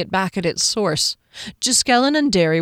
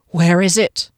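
loud, stern demand; commanding interrogator; menacing guard; 6 dB louder than usual. A narrator's reading. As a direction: demand it as a ghostly sentinel.

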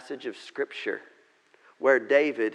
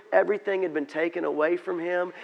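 ordinary talk

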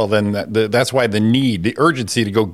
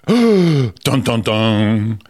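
mock dramatic sting; much slower than usual.

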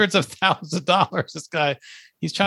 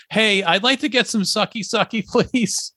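hammed-up polite request; 7 semitones higher than usual. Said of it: "He pulls up to a girl on the corner like".

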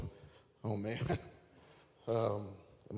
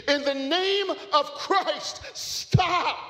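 far higher, loud, slow cry; climactic yell; 10 dB louder, much slower than usual. Acted. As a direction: cry it out at the peak of the story. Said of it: "once again repeated".

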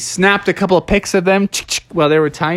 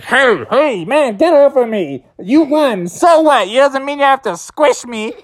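higher annoyed complaint; squished Stinky; 9 semitones higher than usual. Acted. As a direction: protest from under a bear. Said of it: "Stinky's like".

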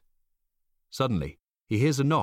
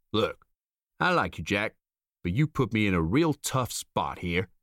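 gruff foreman. A narrator's reading.

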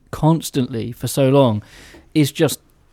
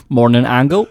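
put-on laughing West Country accent. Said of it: "it comes from Hot Fuzz".

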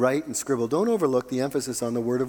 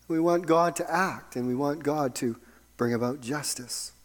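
ordinary delivery

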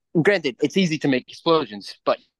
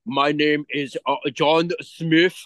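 slow mock Austrian accent; Schwarzenegger impression; slower than usual. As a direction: ham up a heavy Austrian accent, slowly. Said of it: "be like fucking Schwarzenegger".

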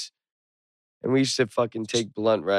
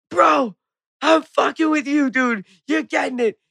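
much higher, louder "Bro, I'm fucking with you"; excited yelling; 5 dB louder than usual. Retold or imitated. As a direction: shout it out to your buddy in the car. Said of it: "I start yelling".

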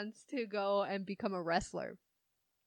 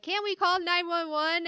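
high, louder whiny question; mock girl; 9 semitones higher, 5 dB louder than usual. Lost teen girl in the rainforest: high, louder whiny plea. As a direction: plead it high like a scared teen. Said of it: "the girl was like".

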